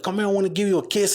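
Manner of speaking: imitating nana. Imitating nana offering kiss.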